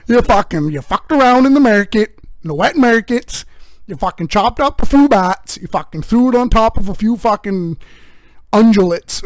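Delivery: put-on loud, high Canadian bloke addressing the boys; rowdy hoser scolding the boys; rowdy Canadian guy. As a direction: bark it like a rough hockey dad. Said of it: "And he comes out".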